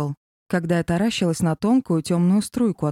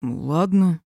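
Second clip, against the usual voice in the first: sighing. Acted. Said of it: "вздохнул Женя".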